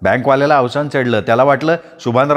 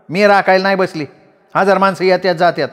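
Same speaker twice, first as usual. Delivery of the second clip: higher woman's voice, quick dismissive retort; Radhi retorting; 6 semitones higher than usual. A narrator's reading. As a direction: brush it off fast. Radhi defends herself. (उडवून लावणारी राधी)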